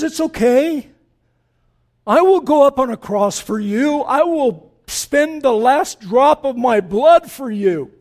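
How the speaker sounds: loud, high, driving preacher voice; full-force preaching. Acted. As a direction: preach it to the back row.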